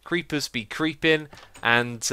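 ordinary talk

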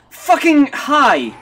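slow, high, loud blurt; high, loud, slow outburst; loud exclamation. Acted.

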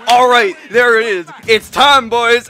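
psyched for the kill; pumped up. Retold or imitated. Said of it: "I'm like".

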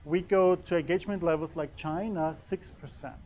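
usual speaking voice

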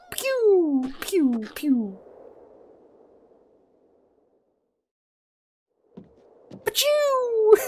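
high squeaky mouth pew; gunshot sound effect. Pretend. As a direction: spit out toy gun noises. Mimicking gunfire sounds.

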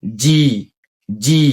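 "জি" with a firm j onset, long ee; slightly hard G. Shown as a demonstration. Teacher asks for a harder G, "জি" firmly started. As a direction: press the first sound of "জি" a little harder. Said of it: "একটু শক্ত হবে।".

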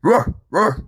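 loud high mock barking; dog bark. Dog barks at the cat, loud mock bark. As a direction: bark it like a playful cartoon dog.

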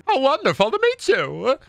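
very high, theatrical cartoon voice; Snagglepuss. Snagglepuss meets someone, high hammy delight. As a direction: gush in a lofty cartoon lilt. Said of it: "Do Snagglepuss".

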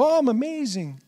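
high, put-on marveling voice; mock self-amazement. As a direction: act out delighted wonder at yourself. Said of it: "and you go".

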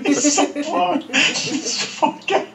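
high burst of laughing; laughing so hard the words break; shocked laughter. Real, not acted. Caller cracks up at angry manager.

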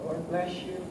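normal talking voice

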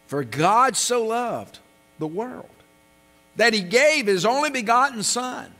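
slow, solemn verse read with emphasis; scripture reading. Acted. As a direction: lift each phrase of the verse to the congregation. Recites the verse again.

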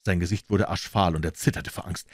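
plain reading voice

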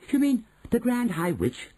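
put-on high, eager boy's cry; excited cry. A narrator's reading. Boy to his grandmother about the witch, excited cry. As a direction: blurt it out in astonished excitement. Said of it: "I cried".

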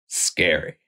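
usual speaking voice

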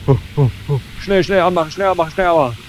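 high, loud frantic urging; panicked hurry. Real, not acted. Gamer panicking as his machine loses power, frantic high urging. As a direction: rush the words as if the machine is failing.

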